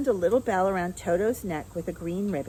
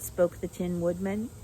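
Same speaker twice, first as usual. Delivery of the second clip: usual speaking voice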